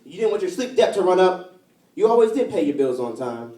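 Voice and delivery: rhythmic, emphatic poetry recital; spoken-word poem. Acted. Poet remembering New York with a lost lover, spoken-word style. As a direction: carry each rhyme through like a slam poet.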